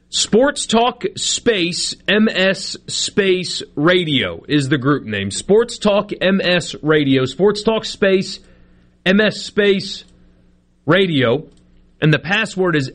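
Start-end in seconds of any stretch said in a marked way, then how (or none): 0.1-4.4 slow dictation. Real, not acted. Dictating group name to listeners. 7.3-11.5 spelling it out slowly, word by word; slow dictation. Real, not acted. Spelling out group name again.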